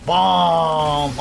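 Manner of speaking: shouted sound effect bam; loud bam. Real, not acted. Gamer yelling bam as he lands a hit.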